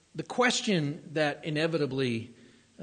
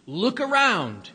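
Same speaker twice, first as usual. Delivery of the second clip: raised, slow command "Look around"; 6 semitones higher, 7 dB louder, slower than usual. Acted. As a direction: call it out like a command. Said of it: "he could have said".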